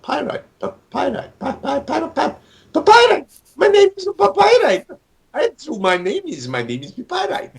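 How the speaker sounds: put-on high-pitched, stammering Puerto Rican-accented jester. Creator voicing his court jester character Pyrite, Puerto Rican accent. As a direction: put on a squeaky Puerto Rican accent, tripping on the first syllable. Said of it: "he has the Puerto Rican accent".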